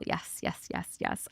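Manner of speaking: soft breathless yes; whispered yeses. Real, not acted. Host agrees with a viewer, whispered yes yes. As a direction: hush it, nodding along.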